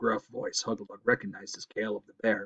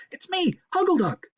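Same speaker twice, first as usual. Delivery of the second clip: very high, eager call; cried out; 4 dB louder than usual. Acted. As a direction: announce yourself urgently. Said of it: "Huggleduck cried".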